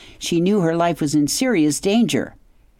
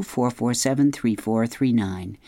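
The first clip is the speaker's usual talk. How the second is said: ordinary delivery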